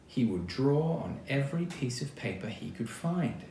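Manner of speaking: ordinary delivery, nothing marked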